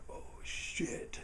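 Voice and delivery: breathless whisper; whispered dread. Retold or imitated. Caught kid whispering to himself as dad finds out, hushed. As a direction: barely breathe the curse.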